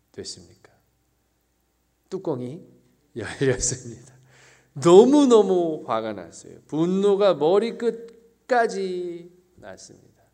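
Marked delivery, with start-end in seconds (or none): 4.7-10.2 loud, slow, heated preaching; angry outburst. Acted. Preacher describing the elder son's rage, loud and slow. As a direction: let the fury rise slowly and loudly.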